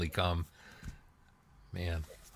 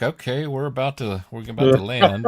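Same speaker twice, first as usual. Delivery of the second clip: pilot voice; 5 semitones higher, 6 dB louder than usual. Retold or imitated. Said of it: "is this your pilot voice".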